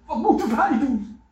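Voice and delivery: loud, high question; urgent plea. Acted. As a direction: call out the question. Soldiers asking John.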